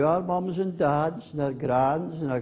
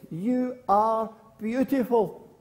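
high, slow, drawn-out call; girl calling to hills; 7 semitones higher, slower than usual. Acted. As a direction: call it out slowly across a valley. Girl in story calls to hills.

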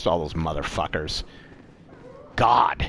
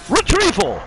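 excited shout; 12 semitones higher, 4 dB louder than usual. Real, not acted. Sudden excited outburst in game.

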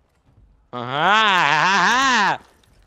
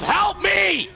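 desperate shout; 10 semitones higher than usual. Real, not acted. Desperate cry during fight.

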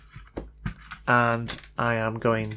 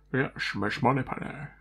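put-on playful Sean Connery Scottish accent, a touch softer; 4 dB quieter than usual. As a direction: tease it like Bond greeting Moneypenny. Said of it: "Sean Connery accent".

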